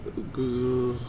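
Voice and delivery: usual speaking voice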